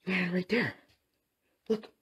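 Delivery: high, loud, urgent; excited. Real, not acted. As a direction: cheer the meter on. Excited over K2 meter.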